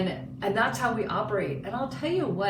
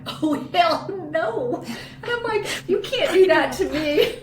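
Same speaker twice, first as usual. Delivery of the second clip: high, loud laughing outburst; laughing protest; 8 semitones higher, 6 dB louder than usual. Retold or imitated. Mom laughing as she refuses to wait for her son. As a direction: protest through laughter, half joking. Reacting to son delaying the talk.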